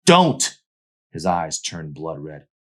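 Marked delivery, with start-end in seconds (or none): 0.1-0.6 loud, high cry of don't; sharp shout. Acted. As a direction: bark out a warning. Arson shouting at Mandy.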